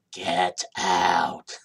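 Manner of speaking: low, eerie spooky whisper; Poltergeist voice. Retold or imitated. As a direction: do the haunted house warning. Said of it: "the movie Poltergeist".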